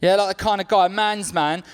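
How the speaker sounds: ordinary talk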